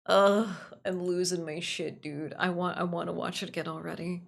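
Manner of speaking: ordinary talk, nothing marked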